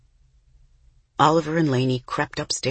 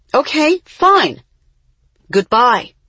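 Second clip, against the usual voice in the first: high, loud and clipped off; curt; 9 semitones higher, 8 dB louder, slower than usual. A narrator's reading. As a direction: end the call in a huff. Mama ending call curtly.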